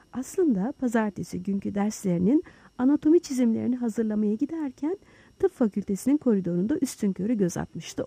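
ordinary delivery

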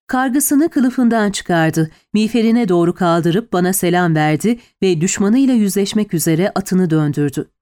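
ordinary delivery, nothing marked

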